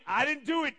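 high, loud defensive denial; protesting child. Retold or imitated. Colin denying he started the fight, high protest. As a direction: imitate a kid indignantly denying blame. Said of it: "Colin's like".

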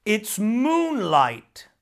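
shouting on. Acted. Water devil insists to Nora it is moonlight, slow loud shout. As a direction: keep shouting him down. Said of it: "shouted the water devil".

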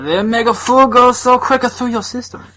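put-on high-pitched caricature Japanese accent; hammed-up fake Japanese accent; mock Japanese accent. Jokey riff after Japanese food.

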